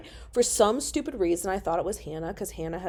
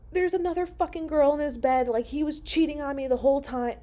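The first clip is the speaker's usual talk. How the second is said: retold distress; 8 semitones higher, faster than usual. Sobbing to mom on phone.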